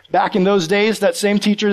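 normal talking voice

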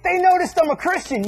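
high-pitched elated announcement; excited pride. Acted. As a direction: announce it like thrilling news. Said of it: "Your attitude should be like".

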